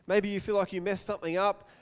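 ordinary talk, nothing marked

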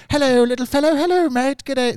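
imitating leprechaun-like Australians. Mocking how Aussie classmates sounded to him, high leprechaun Australian accent. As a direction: squeak G'day like a tiny leprechaun. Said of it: "That's what they sounded like in my head".

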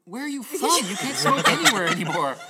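high, loud retold blurt breaking into laughter; re-enacted astonished outburst; incredulous outburst. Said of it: "it just came out of my mouth".

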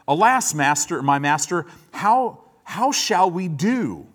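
hammed-up servant in a fluster, drawing out the how; freaked-out servant. Servant sees the army surrounding the city and panics, hammed up. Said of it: "he's a little freaked out right now".

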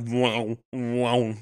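humming lightsaber whoosh; drawn-out vocal lightsaber swings; imitated swinging mop noise. Podcaster imitating mops as lightsabers, humming whoosh. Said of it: "swinging mops around. It's like".

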